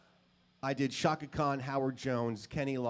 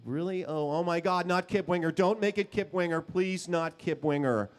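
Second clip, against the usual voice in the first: higher, louder pleading, slowing at the end; mock pleading; 6 semitones higher, 4 dB louder than usual. To producer Beau, begging not to work with Kip, mock pleading. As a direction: beg not to get stuck with him.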